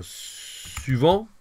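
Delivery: souvent drawn out slowly, rising; slow model word. Shown as a demonstration. Teacher slowly modelling souvent for learners. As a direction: stretch souvent for the class.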